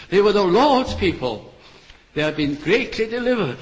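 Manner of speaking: loud, ringing pulpit cry; preaching at full force. Acted. Preacher rousing his church, loud and fervent. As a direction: lift the congregation with rising fervour.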